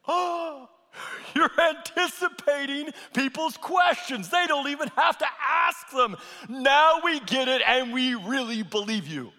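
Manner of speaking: excited. Acted. Disciples quoted in joyful recognition.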